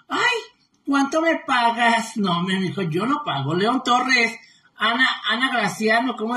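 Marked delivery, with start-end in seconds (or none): 0.1-2.2 lightly mimicked men, opening on a high "ay"; mock their asking for money; mimicked old men. Streamer quoting men who want payment, lightly mimicked. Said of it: "luego los viejos dicen".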